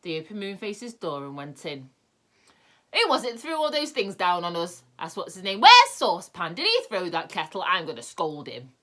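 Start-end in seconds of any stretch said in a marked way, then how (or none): 2.9-8.7 angry. A narrator's reading. Angry visitors demand the culprit.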